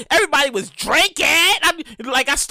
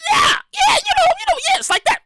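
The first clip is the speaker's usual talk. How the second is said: mock squeaky agreement. Mock hyping the audience.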